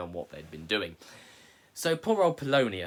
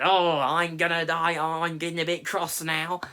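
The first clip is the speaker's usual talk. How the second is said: mock cross complaint; 5 semitones higher, 6 dB louder than usual.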